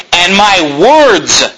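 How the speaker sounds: "words" drawn out slowly, landing hard on the final S; stress on words. Shown as a demonstration. Pastor stressing the plural in scripture, slow and firm. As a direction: lean on the S in "words". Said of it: "notice the S on the end there".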